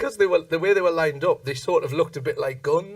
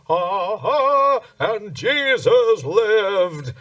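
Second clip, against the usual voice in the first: slow, higher, louder proclamation; grand narrator; 5 semitones higher, 4 dB louder, much slower than usual. Retold or imitated. Mimicking the show's narrator as it reaches its big finish, slow and grand. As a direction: draw out the ending like a pageant voice.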